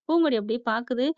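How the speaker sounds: ordinary delivery, nothing marked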